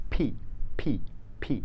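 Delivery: ফি with a puff of air after the p; aspirated p. Shown as a demonstration. Teacher showing the aspirated p to students. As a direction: breathe out hard on ফি. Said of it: "পি না".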